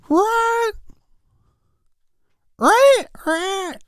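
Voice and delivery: squeaky high right, right; way up high, sarcastic agreement; mock falsetto. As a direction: squeak it out mockingly. Sarcastic falsetto after the joke.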